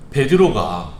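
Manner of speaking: normal talking voice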